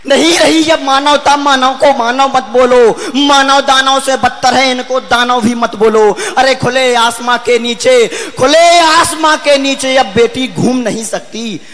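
ringing, sustained verse delivery; forceful poem declamation. Acted. Poet denouncing lost humanity to the audience, forceful declamation. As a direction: proclaim it to a whole hall.

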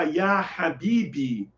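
"ya Albi, ya Allah" said slowly; slow Arabic examples. Shown as a demonstration. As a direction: spell out "ya Albi, ya Allah" at half pace. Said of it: "Então você pode botar".

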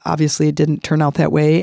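ordinary talk, nothing marked

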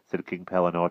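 usual speaking voice

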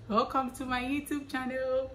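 high, sustained channel welcome; singsong welcome, held high to the end; mock YouTuber intro. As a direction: play the stock vlogger opener. Repeats stock channel intro.

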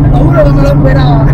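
excited. Real, not acted. Passenger reacting mid ride.

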